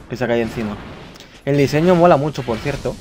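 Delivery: usual speaking voice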